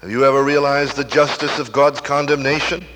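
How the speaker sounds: normal talking voice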